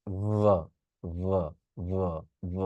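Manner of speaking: ভ buzzed as a v with the throat vibrating, no air; voiced v demo. Shown as a demonstration. Teacher demonstrating the correct vibrating v sound. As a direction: hum the ভ as a voiced v. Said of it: "এখানে vibration কাজ করছে".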